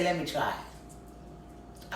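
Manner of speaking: slow breathy whisper. Retold or imitated. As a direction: whisper it slowly. Quietly asking to try drugs.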